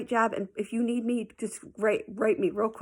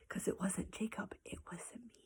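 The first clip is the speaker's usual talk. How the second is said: guilty whisper; 14 dB quieter than usual. Real, not acted. Guilty aside to viewers.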